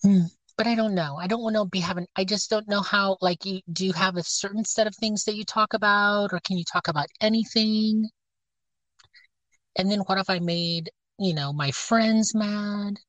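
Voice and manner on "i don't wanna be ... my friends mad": ordinary delivery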